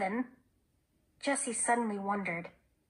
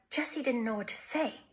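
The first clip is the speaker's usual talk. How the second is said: ordinary delivery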